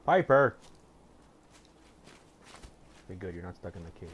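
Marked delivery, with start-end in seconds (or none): 0.0-0.5 loud call across the map. Real, not acted. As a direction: shout her name to find her. Calling companion who is lost.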